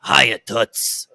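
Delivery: like she smokes a pack a day; smoky moll voice. Shown as a demonstration. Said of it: "a little bit of "I smoke a cigarette".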